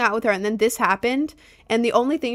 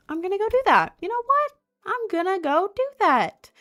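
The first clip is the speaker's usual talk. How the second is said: much higher, eager and determined; excited resolve; 11 semitones higher than usual. Retold or imitated. Teen planning to leave home, higher and eager. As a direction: pump yourself up, teenage excitement. Quoting her teenage plan.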